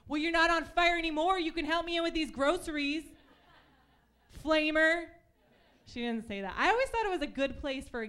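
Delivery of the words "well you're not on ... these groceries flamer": exasperated mom. Retold or imitated. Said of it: "she was like".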